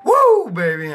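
loud hyped shout; excited yell. Real, not acted. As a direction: shout it out with hype. Hype yell after jog.

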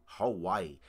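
usual speaking voice

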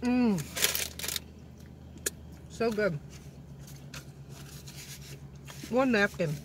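talking with mouth full; chewing. Real, not acted. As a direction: between bites of food. Seen 2: Face clear; jaw working on burrito bite, fingers at lips.

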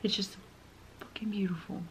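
soft, choked whisper; emotional whisper. Real, not acted. Overwhelmed by the song, whispering emotionally. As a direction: barely get the words out, overwhelmed. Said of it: "I'm getting emotional".

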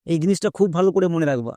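ordinary delivery

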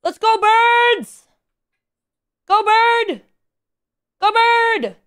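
high, loud drawn-out cheering; hype cheer. Pretend. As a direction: cheer the bird on like a fan. Hyping up the bird.

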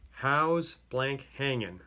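normal talking voice

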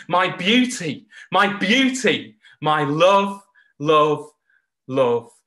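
delighted declaration. Retold or imitated. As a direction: sing out your delight over a treasured toy. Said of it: "declared in this voice".

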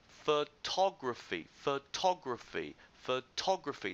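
"photography" slow, with TOG stretched and stressed; stress on second syllable. Shown as a demonstration. Teacher drilling students on a problem word, stress on TOG in "photography". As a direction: model "photography" for learners, leaning on the TOG syllable. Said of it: "The stress is on the second syllable".

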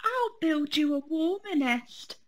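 lightly voiced cub trying again; little bear voice. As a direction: set about it with fresh resolve. Fred tries again.

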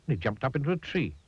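plain reading voice

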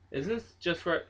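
ordinary talk

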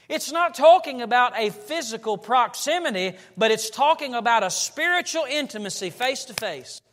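loud, high, driving sermon voice; preaching at full force. Acted. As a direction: preach it out with rising fervour.

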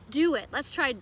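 ordinary talk

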